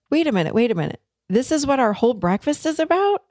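mildly incredulous, pitch rising; retold disbelief. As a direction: replay your disbelief with a lifted voice. Acting out disbelief at breakfast's reason.

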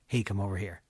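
low soft creepy lure; creepy knock. Pretend. Jupiter at Earth's door, creepy low murmur. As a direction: lean into the creepiness quietly. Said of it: "Super creepy".

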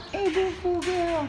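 sing-song pet voice way up high; high dog-directed coo. Real, not acted. Cooing to a shelter dog.

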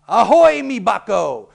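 loud high hearty ahoy; pirate greeting. Shown as a demonstration. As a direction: hail a mate across the deck. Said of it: "If you say".

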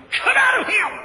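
sudden high, loud exorcism shout; commanding shout. Retold or imitated. Preacher casting out tendencies in Jesus' name, commanding shout. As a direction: order the demon out at full voice.

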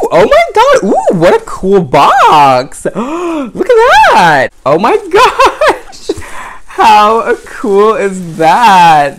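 high, loud, slow gushing over the box; excited squealing. Real, not acted. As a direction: squeal with delight at each detail. Opening a collectible doll box.

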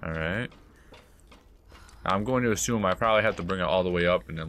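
usual speaking voice

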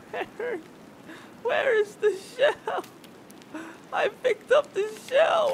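very high, squeaky crying voice; tearful squeal. Pretend. Player mourning a turtle killed for nothing, high squeaky crying. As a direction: wail in a tiny, high-pitched sobbing whine. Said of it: "I'm actually tearing up right now".

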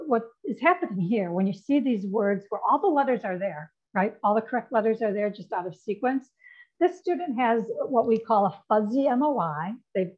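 normal talking voice

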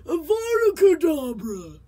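hammed-up Voldemort casting a curse. Shown as a demonstration. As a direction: hiss the curse like a breathless old villain. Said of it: "you sound like Voldemort".

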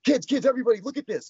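high, eager summons; excited retold call to the family; imitated calling out. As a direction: mimic someone rounding everyone up to look. Retold father calling family over.

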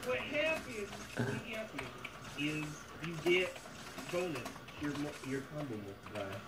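put-on southern accent. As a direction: explain it like a Southern good ol' boy. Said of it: "And in a southern accent".

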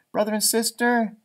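plain reading voice

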